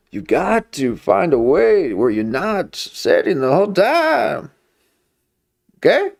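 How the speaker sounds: high, slowed preachy lecture voice; put-on preachy voice. Vlogger lecturing viewers on sitting, in a high preachy voice. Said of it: "I don't need to be talking like this".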